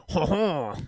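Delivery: comic French vampire laugh; bad French accent. Co-host answers in French vampire character, mock French accent. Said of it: "I can't really do a French accent".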